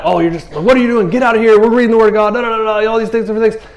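high, indignant imitation of a scolding; mock mad. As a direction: play someone put out by an interruption. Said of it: "not mad".

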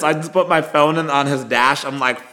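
normal talking voice

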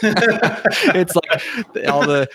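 words squeezed out through laughter; laughing. Real, not acted. Laughing while describing movie.

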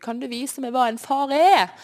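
high, loud desperate cry; desperate prayer shouted to God. Retold or imitated. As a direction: call out to God in despair. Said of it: "Jeg ropte det ut".

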